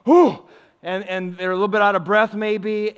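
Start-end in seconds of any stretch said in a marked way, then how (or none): 0.0-0.4 mock winded exhale. Shepherds out of breath.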